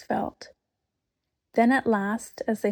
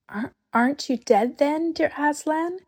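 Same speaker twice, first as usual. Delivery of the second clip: timid stammer; 6 semitones higher than usual. A narrator's reading. Lucy stammering in awe.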